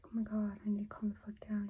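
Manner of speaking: hushed fast self-talk; whispered inner panic. Retold or imitated. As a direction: whisper it to yourself quickly. Retold panicked inner thought.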